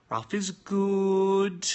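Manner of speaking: slow, high, loud character voice; Ralph voice. Acted. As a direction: drawl it out as Ralph, the Australian. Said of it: "Ralph is this character that I play".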